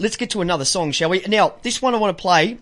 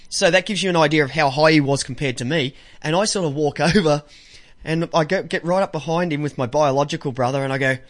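usual speaking voice

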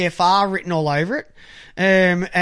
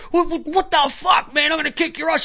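higher, huffy comeback of an offended parent; imitating a defensive dad, pitched up; mock indignant parent; 7 semitones higher than usual. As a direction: play the outraged father squaring up. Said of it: "And when they go".

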